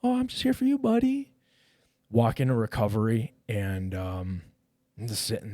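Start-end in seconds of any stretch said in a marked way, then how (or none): 0.0-1.3 high-pitched imitation of a friendly pal; buddy's way of talking. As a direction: imitate your buddy greeting you, way up high. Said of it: "that's how he talks".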